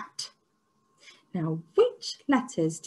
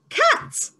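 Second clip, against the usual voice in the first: Pat cried out much higher; thrilled high exclamation; excited reveal; 4 dB louder than usual. Shown as a demonstration. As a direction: burst out the word with delight. Teacher reveals word excitedly.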